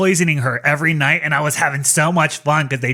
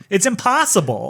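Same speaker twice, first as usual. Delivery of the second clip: ordinary talk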